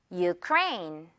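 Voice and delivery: "Ukraine" said yoo-KRAYN; model pronunciation. Shown as a demonstration. Teacher has learners repeat with the U sound, "Ukraine" as yoo-KRAYN. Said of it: "One more time".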